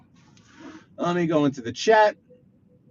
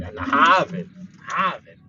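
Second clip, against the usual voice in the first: Boston "Harvard" with stretched, R-less vowel; mock Boston accent; 4 dB louder, slower than usual. New Yorker mocking Boston talk, exaggerated Boston accent. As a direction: parody a Bostonian drawing out "Harvard". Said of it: "That's not how we sound".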